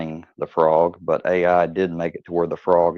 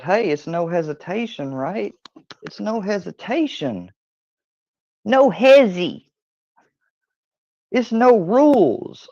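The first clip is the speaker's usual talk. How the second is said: high-pitched catchphrase; 11 semitones higher than usual. Pretend. Speaker touting his own catchphrase, high-pitched and playful. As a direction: jump up into a high, drawn-out slogan voice.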